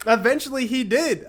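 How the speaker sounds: high, loud, through a laugh. Real, not acted. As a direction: carry on telling it while cracking up. Laughs after acting frantic plea.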